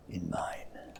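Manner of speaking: a sharp, cold whispered end; stern whisper. A narrator's reading. Scrooge shutting out his nephew, stern whisper. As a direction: hiss the last words. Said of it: "returned the uncle sternly".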